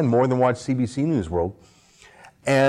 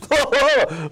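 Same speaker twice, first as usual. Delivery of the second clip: mock laughter; 12 semitones higher than usual. Host mimicking CNN panel laughing at voters, mock cackle. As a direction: laugh like the hosts mocking voters.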